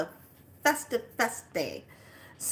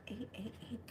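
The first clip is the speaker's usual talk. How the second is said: soft, secretive eight eight eight; hushed reveal; 3 semitones higher, 17 dB quieter than usual. Retold or imitated. Telling the shop lady the date as a soft secret. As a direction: let her in on a secret.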